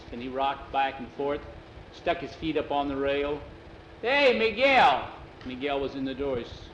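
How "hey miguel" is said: loud, high, drawn-out call. Acted. Jose hails Miguel from the porch rocker, calling out. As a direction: holler the name across the house.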